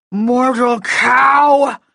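slow, roaring contemptuous insult; furious shout. Acted. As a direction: bellow the insult, drawn out. Furious wizard insulting her.

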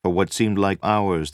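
ordinary delivery

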